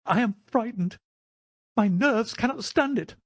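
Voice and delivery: very high-pitched frightened little man; on the edge of panic, pitched right up; half blubbering with fear. Acted. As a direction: plead in a high, fearful voice. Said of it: "half blubbering with fear".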